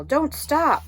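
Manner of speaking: high, loud urgent yell. Acted. Henry yelling while running in the dark, high loud. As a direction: push them on as they run. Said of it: "would yell".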